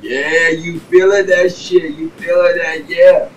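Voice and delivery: slow, high, teasing drawl; mock teasing laugh. Adults teasing the high teenager, slow high mock taunt. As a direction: stretch it out, mocking the high kid. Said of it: "they laughing like".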